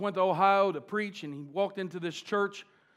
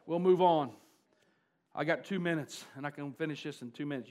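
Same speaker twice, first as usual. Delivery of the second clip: usual speaking voice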